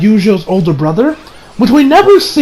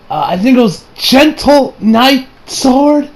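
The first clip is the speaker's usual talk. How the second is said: normal talking voice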